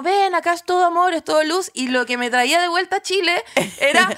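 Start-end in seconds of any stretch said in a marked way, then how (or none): 0.0-1.7 higher, beckoning heavenly voice; mock celestial welcome; voice from the tunnel. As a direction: invite them into the light. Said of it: "al final del túnel era como".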